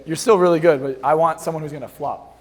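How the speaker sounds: usual speaking voice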